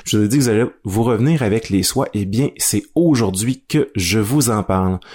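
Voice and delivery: ordinary talk, nothing marked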